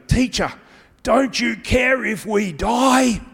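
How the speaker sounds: high, loud, slow desperate yell; panicked shout. Retold or imitated. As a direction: yell to wake a sleeping man. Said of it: "they wake him up saying".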